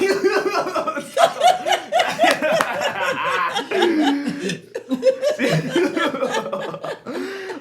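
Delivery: long, very high, loud silly laugh. Shown as a demonstration. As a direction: crack up in a goofy, overblown laugh. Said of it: "show these people what I'm talking about".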